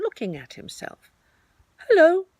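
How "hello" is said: bright greeting. Acted. Pooh greets Tigger in morning.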